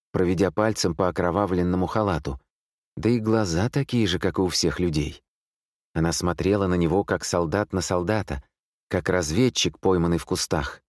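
ordinary delivery, nothing marked

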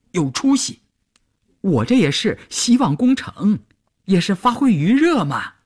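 put-on high-pitched, blustery older comrade on the phone; loud, fussy chatterbox. A narrator's reading. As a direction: rattle on noisily, voice pitched well up. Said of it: "咋咋呼呼地喊道".

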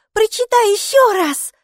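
put-on high, loud childish demand; a thrilled girl begging loudly; excited little girl. A narrator's reading. Mikey begs mom to reread, high loud child voice.

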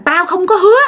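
high-pitched annoyed retort; acted fed-up outburst; irritated scolding. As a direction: scold back, pitch jumping up. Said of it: "mới bực, mới chửi rằng".

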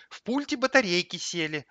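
high-voiced aggrieved explanation; indignant. A narrator's reading. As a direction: shift the blame in protest. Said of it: "возмутился телевизор". (обиженно, повышенным тоном)

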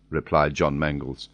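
ordinary delivery

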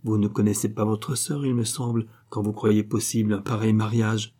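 usual speaking voice

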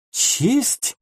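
high astonished one-word question; be taken aback by the word; surprised young lady echoing him; amazed. A narrator's reading. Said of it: "изумилась Люси".

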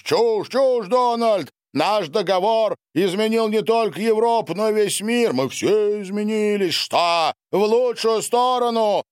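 high-pitched, heated retort; angry exclamation. A narrator's reading. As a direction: argue back hotly. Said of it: "зло воскликнул Дональд". (злые возгласы высоким голосом)

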